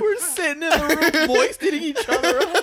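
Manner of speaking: high laughing voice; laughing hard through words. Real, not acted.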